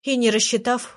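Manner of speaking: plain reading voice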